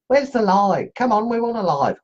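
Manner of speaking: put-on half-hearted take on Brett's voice, without his accent; lightly imitating a friend demanding a live stream. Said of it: "Although I can't do it in his accent".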